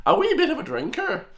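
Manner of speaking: exaggerated Irish accent, high-pitched on wee; mock Irish accent. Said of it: "what every single American kinda thinks that Irish people sound like".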